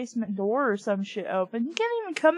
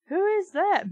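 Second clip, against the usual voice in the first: cried out; 9 semitones higher, 4 dB louder than usual. A narrator's reading. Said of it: "Mindy cried".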